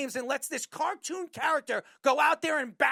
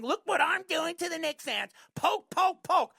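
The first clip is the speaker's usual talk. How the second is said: high, sing-song teasing player voice; mocking taunt; 6 semitones higher than usual. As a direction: needle them like a smug trash-talker.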